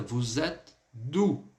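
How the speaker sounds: "Vous êtes d'où?" drawn out, gap before "d'où"; slow demonstration. Shown as a demonstration. Teacher showing how the question turned out, slow careful model. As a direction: say it slowly in two parts for learners. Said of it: "por isso que ficou agora".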